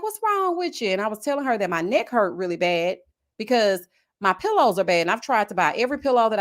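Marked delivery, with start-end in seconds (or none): 0.0-0.9 lightly voiced grandma, high; raised pitch grandma impression.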